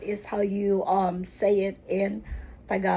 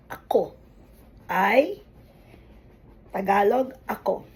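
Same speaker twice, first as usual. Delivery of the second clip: normal talking voice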